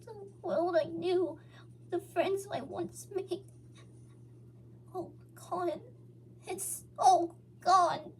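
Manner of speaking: high, slow, broken grief; mourning a lost world through tears; tearful lament. A narrator's reading. Beaten Hog weeping over the destroyed world, high and slow. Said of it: "as torrent of tears escaped".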